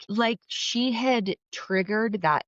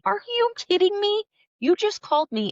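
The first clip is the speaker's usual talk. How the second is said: outraged disbelief; 4 dB louder than usual. Retold or imitated. Outraged after sister hangs up.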